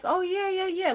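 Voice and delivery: imitating someone happily saying yes; easygoing agreement, imitated. Mimics Don readily agreeing to request.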